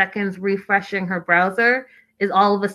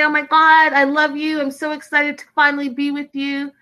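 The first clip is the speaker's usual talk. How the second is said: mock gushing excitement; 7 semitones higher than usual. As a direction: gush it out like the reaction he should have had. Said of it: "He doesn't say".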